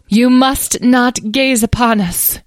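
high, slow, commanding fairy; lofty fairy decree; imperious. A narrator's reading. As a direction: issue a haughty royal order, drawn out. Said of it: "in an imperious tone".

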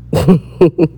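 a quick amused laugh; short laugh. Real, not acted. Vlogger laughing at her own whispered plea, amused laugh. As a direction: laugh at your own bit.

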